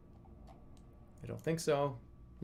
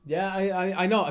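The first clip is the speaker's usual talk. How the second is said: usual speaking voice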